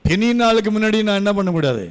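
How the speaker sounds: normal talking voice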